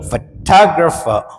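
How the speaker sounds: Photographer very slow, weight on టా; stress on second syllable. Shown as a demonstration. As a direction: stretch photographer and punch టా. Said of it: "టా మీద సెకండ్లోనే stress". (టా మీద ఒత్తిడితో photographer)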